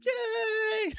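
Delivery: put-on very high, loud child's yay; kids screaming yay. Kids cheering for Care Bear, shrill yay. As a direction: shriek it like excited kids. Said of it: "kids scream".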